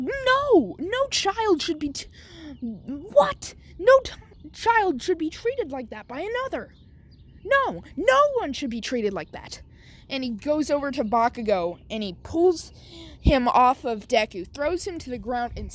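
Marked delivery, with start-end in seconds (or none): 0.0-9.6 outraged adult stepping in; principal's outcry. Acted. Principal stops the beating.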